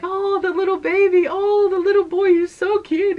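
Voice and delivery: put-on high, sing-along gushing over a baby; cooing ladies. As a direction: coo over the little ones, voice way up. Two ladies coo over her children.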